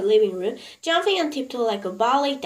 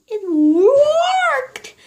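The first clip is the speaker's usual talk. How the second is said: much higher and louder joyful cry; 14 semitones higher, 10 dB louder than usual. A narrator's reading. Mrs Silver tells Mr Hoppy the spell worked, joyful cry. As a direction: burst out with delight. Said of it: "she was crying".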